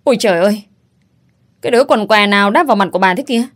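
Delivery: loud, fast, indignant yell; woman hit in the face by a pizza yells; shrieking outburst. Acted. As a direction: shriek it at whoever threw the pizza. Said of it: "la toáng cả lên".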